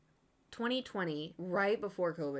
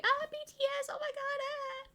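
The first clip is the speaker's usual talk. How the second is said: high, breathless fangirl shriek; fangirl squeal. Retold or imitated. Mocking her own fangirling over BTS, a high squeal. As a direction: squeal like a starstruck fan.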